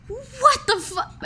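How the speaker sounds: high, slow, stunned half-curse; shocked. Acted. Elaine sees her girlfriend hit by a car, shocked. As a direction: caught totally off guard. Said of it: "she's like".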